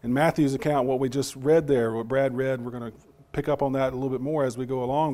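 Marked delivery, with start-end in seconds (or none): none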